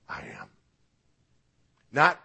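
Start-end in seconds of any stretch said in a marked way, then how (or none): 0.1-0.5 a very low, deep hushed "I am". Acted. Preacher voicing Jesus answering the soldiers, deep and hushed. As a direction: drop the voice deep and soft for the divine name.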